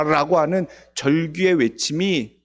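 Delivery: ordinary delivery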